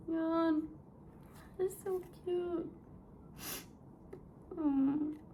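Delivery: high, soft, weepy voice; crying at how cute it is; tearful cooing. Real, not acted. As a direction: coo through happy tears. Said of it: "I'm actually crying".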